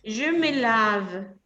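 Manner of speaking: "lave" ending on a clear V, said slowly; final V sound. Shown as a demonstration. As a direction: land firmly on the V of "lave". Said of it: "hasta el sonido de la V". ("lave" terminando en una V clara)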